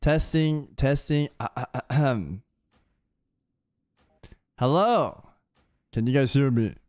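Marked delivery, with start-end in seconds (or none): none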